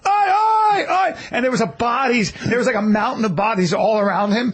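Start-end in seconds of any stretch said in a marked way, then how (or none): 0.1-1.2 high, repeated strike cries; imitated fight yell. Retelling Mayhem fighting in the street, high shouts. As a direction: yell one out for each elbow thrown. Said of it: "he was just going".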